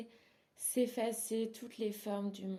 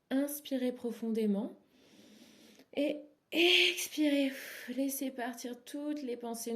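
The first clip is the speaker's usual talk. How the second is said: raised, rising breath cue, then a louder, higher push on the exhale; 5 semitones higher, 5 dB louder than usual. Shown as a demonstration. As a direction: lead the breath in, then out with force. Coaching a deep breath aloud.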